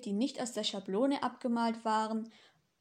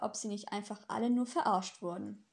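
ordinary delivery

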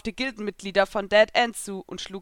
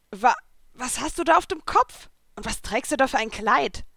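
stammering, higher, louder surprise; flustered; 4 semitones higher, 4 dB louder than usual. A narrator's reading. Natsu flustered by Lucy's crown and dress. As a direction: caught off guard, stumbling over words. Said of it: "fragte er verdattert".

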